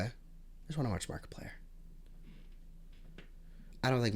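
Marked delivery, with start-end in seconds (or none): 0.1-1.5 much softer, breathy whispered longing; wistful whisper of what she came for. Real, not acted. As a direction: whisper it like a private plea. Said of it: "repeated as whispered take".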